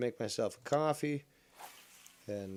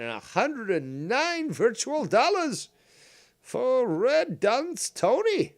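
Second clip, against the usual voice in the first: high, loud hammed-up celebration; mock-triumphant; 10 dB louder, slower than usual. Streamer announcing the catch's worth, mock-triumphant. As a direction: crow over the prize in a high voice.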